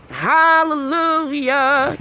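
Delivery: long, loud, high hallelujah; joyful hallelujah. Real, not acted. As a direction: stretch it out in celebration. Signing off the stream.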